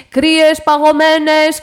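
very loud, stretched-out sales cry; rival vendor's call. A narrator's reading. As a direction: bellow your cold drinks slowly to the crowd. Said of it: "απάντησε ο καφετζής". (δυνατό, συρτό διαλάλημα καφετζή)

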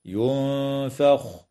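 ينفخ said slowly, the ن hidden in a light nasal hum before the ف; hidden nun. Shown as a demonstration. Teacher isolates ينفخ to show students the hidden nun. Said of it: "إخفاء للنون الساكنة".

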